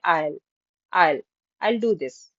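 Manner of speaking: I'll said like file, one syllable; contracted I'll. Shown as a demonstration. Teacher drilling I'll like file. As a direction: glide I'll into do this. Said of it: "जैसे हम फाइल पढ़ते हैं ना".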